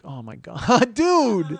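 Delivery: high, loud exclamation bursting up; astonished outburst. Retold or imitated. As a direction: blurt it out in loud, high disbelief. Reacts to her bus news.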